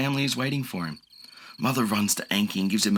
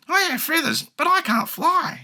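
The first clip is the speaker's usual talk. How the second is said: very high little bird voice; chirpy high creature; feathered friend voice; small bird creature. A narrator's reading. Voices Shishi.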